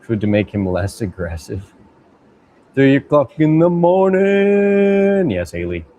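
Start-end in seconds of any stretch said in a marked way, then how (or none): 2.8-5.3 high, slow drawn-out call; sing-song late night announcement; mock announcement. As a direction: stretch it out high and loud. Late night stream banter.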